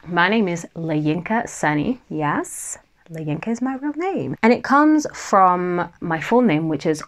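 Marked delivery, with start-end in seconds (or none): none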